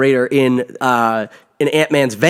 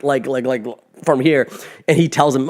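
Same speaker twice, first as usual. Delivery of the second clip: ordinary talk, nothing marked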